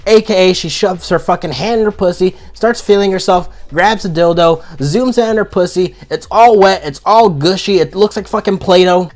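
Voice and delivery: usual speaking voice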